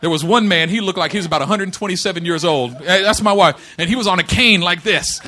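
loud, high, laughing through the words; laughing storytelling. Real, not acted. Pastor laughing as an old man passes him, loud. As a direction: crack up while telling it.

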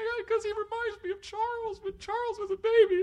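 high, whimpering imitation of himself in tears; mock crying voice. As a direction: ham up his own blubbering. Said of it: "That's how you cry?".

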